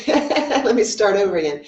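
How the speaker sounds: laughing through a fresh start; real embarrassed laugh. Real, not acted. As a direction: laugh off your slip as you restart. Laughs at own slip.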